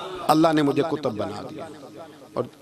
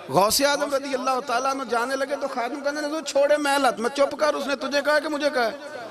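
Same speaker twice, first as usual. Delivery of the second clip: higher, mock-indignant scolding; scolding servant; 10 semitones higher than usual. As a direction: snap at the boy like an annoyed servant.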